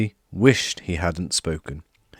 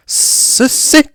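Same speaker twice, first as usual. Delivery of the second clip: high, loud wail; tearful high wail; wailing elf; 9 dB louder, much slower than usual. A narrator's reading. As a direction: wail it in disbelief. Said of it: "he wailed".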